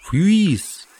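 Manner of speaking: sharp high whistle; whistled call. Acted. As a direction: whistle the password. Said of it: "он просвистел боевой пароль".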